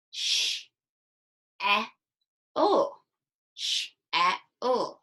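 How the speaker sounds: "Sh-, e, l" spelled out sound by sound with gaps; sounding out letters. Shown as a demonstration. Teacher sounds out "shell" piece by piece for the class. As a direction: break "shell" into separate sounds, one at a time. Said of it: "Can you blend S H together?".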